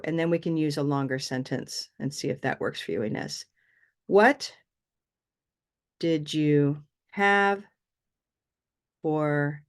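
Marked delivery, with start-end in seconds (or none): 4.1-9.6 slow, long pauses after 'What' and 'have'; word-group demo. Shown as a demonstration. Teacher showing students the groups, slow and separated. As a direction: break it up: 'What', 'did you have', 'for'. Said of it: "now watch what we do".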